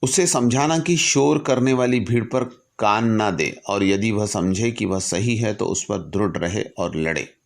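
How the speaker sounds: formal letter reading. Acted. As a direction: read it like a solemn public address. Reads Lincoln's letter aloud. (गंभीर भाव से पत्र का पाठ)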